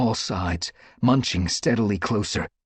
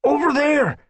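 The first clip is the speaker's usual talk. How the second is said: high, loud call; crying out; 6 dB louder than usual. A narrator's reading. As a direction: cry out pointing. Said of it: "One Whisker cried".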